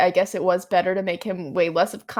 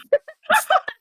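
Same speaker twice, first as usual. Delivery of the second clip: high squealing laugh. Real, not acted. Laughs at British word swap.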